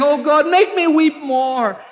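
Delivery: very high, pleading lament; acted penitent crying out to God; Jeremiah's anguished prayer. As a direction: beg God with high, sustained urgency. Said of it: "He was saying".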